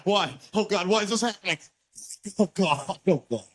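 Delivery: mock anguished whining; despairing victim. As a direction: wail at the heavens.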